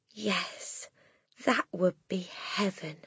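soft, hushed and moved; softly. Acted. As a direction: breathe it tenderly, near tears. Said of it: "Miss Honey said softly".

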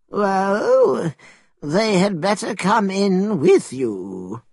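put-on high old man's voice, hesitant welcome. A narrator's reading. Old man inviting the followers inside, old man's voice. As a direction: let them in as an elderly host.

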